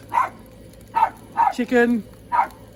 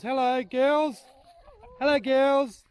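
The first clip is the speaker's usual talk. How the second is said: calling chickens; 9 semitones higher, slower than usual. Real, not acted. Greeting hens in the yard.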